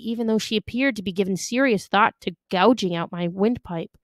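ordinary delivery

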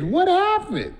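a high nosy what happened; curious question. Retold or imitated. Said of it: "That's why I love to say".

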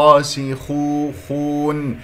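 الراسخون drawn out slowly, heavy خ; slow recitation of الراسخون; tajweed demonstration. Shown as a demonstration. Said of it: "الخاء مفخمة مرتبة ثالثة لأنها مضمومة".

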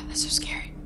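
whisper. Real, not acted. Hiding in dark from animatronics.

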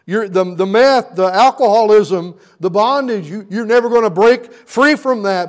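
loud, high, driving preacher voice; a crescendo of revival preaching; full-force preaching. Acted. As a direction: preach it with fire to the congregation.

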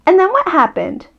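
put-on high, curious child's question; eager little cub. Child character eager for more.

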